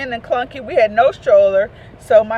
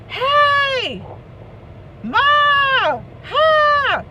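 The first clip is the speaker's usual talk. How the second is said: put-on baby hollering; much slower than usual. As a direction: bellow it like a toddler calling her mom. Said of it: "she's yelling".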